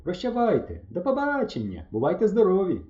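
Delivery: high, lively goodbyes called out; farewell calls. A narrator's reading. Pioneers parting from the boys, calling out. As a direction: call out each goodbye in a lifted voice. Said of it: "Залунали вигуки".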